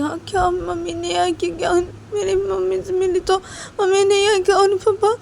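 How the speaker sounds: put-on high child's voice, breaking into crying; tearful little girl. Little daughter to her mother, tearful and confused. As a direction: cry out the question like a confused small child.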